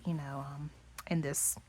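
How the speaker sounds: normal talking voice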